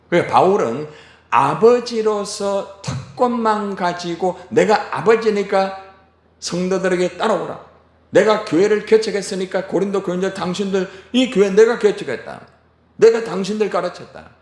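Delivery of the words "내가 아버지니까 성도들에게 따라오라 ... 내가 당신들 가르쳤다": higher and louder bossy demand, slowing at first and fast at the end; pompous authoritarian lecture; domineering father. Retold or imitated. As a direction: lay down the law to the Corinthians. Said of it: "이게 아닙니다".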